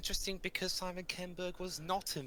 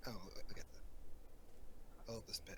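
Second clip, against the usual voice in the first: soft, unvoiced whisper; 6 semitones lower, 12 dB quieter than usual. Real, not acted. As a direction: hush it to yourself in delight. Fan whispering during favourite scene.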